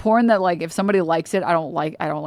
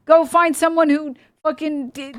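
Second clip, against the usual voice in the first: ordinary delivery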